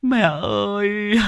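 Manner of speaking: high, slow sobbing lament; keen over a dead mother; grieving son wailing over his mother's body; wailing in grief. Acted. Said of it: "Chú Rêu về đến nhà thì mếu máo".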